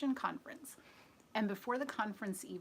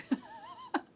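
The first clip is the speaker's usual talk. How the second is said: high squeal of a laugh; real high-pitched laugh; 3 dB louder than usual. Real, not acted. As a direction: let a squeaky laugh escape. Laughing at chili comment.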